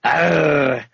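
hammed-up pirate arr; Scottish pirate growl. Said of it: "Scottish pirate".

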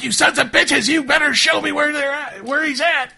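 high, loud, furious demand; angry threat. Acted. Game master as armed woman confronting the guards, angry threat. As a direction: threaten them at full pitch. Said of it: "and then she's like".

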